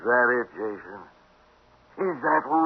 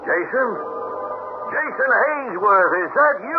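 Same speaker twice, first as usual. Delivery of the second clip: rising, higher voice of sudden recognition; surprised recognition; 10 semitones higher, slower than usual. Acted. Ernie recognizing his old partner, surprised and delighted. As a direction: call out in happy disbelief.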